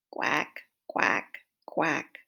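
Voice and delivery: quick quacking duck noise. Retold or imitated. Reading to children, imitating a duck quack. Animal sound imitated for kids.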